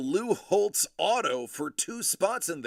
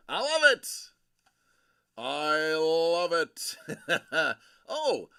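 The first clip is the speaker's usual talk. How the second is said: delighted; 2 semitones higher, 7 dB louder, much slower than usual. Real, not acted. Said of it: "delighted by baby".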